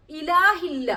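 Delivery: normal talking voice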